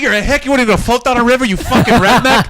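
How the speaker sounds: high-pitched mock outrage, imitating relatives; mocking disbelief. Family ribbing Judy over rafting, mocking disbelief. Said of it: "They were calling her a hick".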